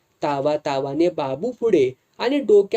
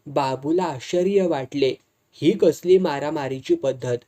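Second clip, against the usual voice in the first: plain reading voice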